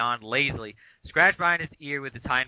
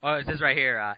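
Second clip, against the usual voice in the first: normal talking voice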